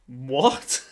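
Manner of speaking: loud shocked what rising up. Retold or imitated. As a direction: burst out in disbelief. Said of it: "reacting more and more shocked".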